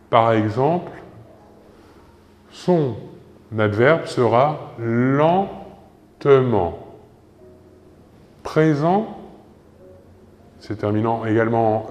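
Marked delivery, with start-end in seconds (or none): 4.8-6.8 "lentement" slowly, split into syllables. Shown as a demonstration. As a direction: model "lentement" slowly for learners. Teacher models the derived adverb. (« lentement » détaché, syllabe par syllabe)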